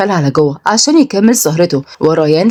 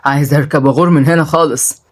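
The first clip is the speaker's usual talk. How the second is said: put-on low, dismissive drunk; surly drunk man; 4 semitones lower than usual. Drunk man brushes off the woman scolding him, surly. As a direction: shush her and grumble low.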